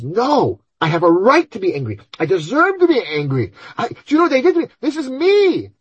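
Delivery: high, loud mock-indignant cry climbing to a near shriek; indignant outburst. The angry person insisting to the good voice, indignant and shrill. Inner voice defending its anger.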